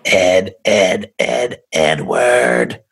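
very slow, drawn-out eerie calling of the name; creepy spirit calling. Acted. Spirit taunting investigator Ed, slow eerie calling. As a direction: stretch each name like a haunting. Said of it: "that voice came from her".

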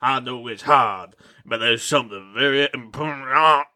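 a hint of a grave vampire dad telling his tale; lightly voiced dramatic father.

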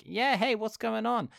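high, casual welcoming host; a hint of a chilled-out rich guy offering a drink; lightly voiced party host. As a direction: invite him in lazily. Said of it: "a man who's just like".

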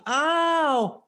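high, pained fake scream; old lady crying out that he's hurting her; mock screaming. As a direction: wail it like a victim faking hurt. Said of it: "she starts screaming".

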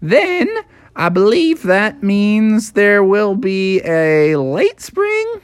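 high-pitched, chatty cartoon groundhog; Punxsutawney Phil. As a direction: show off your town as a tiny celebrity. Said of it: "Phil was like".

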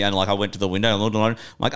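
usual speaking voice

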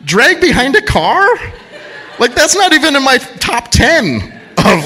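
high-pitched retold disbelief; mock incredulity. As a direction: repeat it back, baffled and high. Retells his disbelief at her guess.

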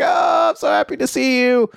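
high, bright overjoyed father's greeting; a doting dad bursting with joy; gushing dad. Retold or imitated. Dad gushing as his son walks in, high and overjoyed.

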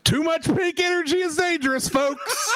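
high-pitched joking warning breaking into laughter; laughing. Real, not acted. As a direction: crack up on the last word. Joking warning amid shared laughter.

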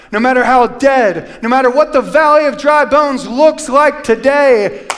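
high, loud, fervent pulpit preaching; preaching at full force. Acted. Pastor preaching loudly to his church about Jesus.

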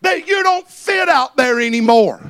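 loud, high-pitched preacher's cry; full-throated revival preaching; preaching at full force. Acted. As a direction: preach it to the rafters.

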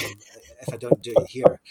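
ordinary delivery

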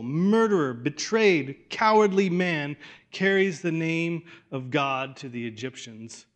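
ordinary talk, nothing marked

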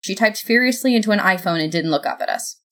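plain reading voice

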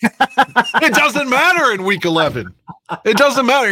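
a hint of a wrestler's catchphrase; The Rock. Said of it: "to quote The Rock".

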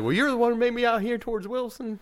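high-pitched mock indignation; retold indignant retort. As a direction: imitate her protesting in a high voice.

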